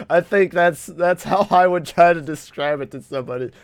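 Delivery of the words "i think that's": ordinary delivery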